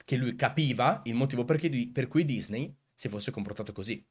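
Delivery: ordinary delivery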